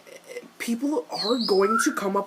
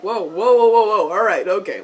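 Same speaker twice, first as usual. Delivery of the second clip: loud string of whoas; mock alarmed halting; 9 dB louder than usual. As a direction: call a halt, loudly putting on the brakes. Acting out halting someone.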